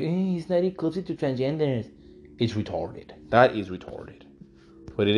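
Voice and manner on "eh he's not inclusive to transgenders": whiny complainer. Retold or imitated. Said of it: "you complaining about it".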